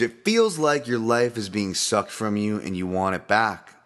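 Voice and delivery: ordinary delivery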